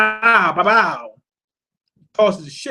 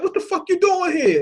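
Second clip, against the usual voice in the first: high, startled Virgo voice pushed up from his own; Virgo shocked; 11 semitones higher than usual. Retold or imitated. Virgo, high and startled. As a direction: Virgo catching Corazon bleeding in the snow, raised startled voice. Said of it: "And Virgo's like".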